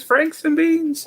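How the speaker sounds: squeaky high-pitched franks and beans; silly high voice. Pretend. Hosts riffing on a joke, in a squeaky silly voice.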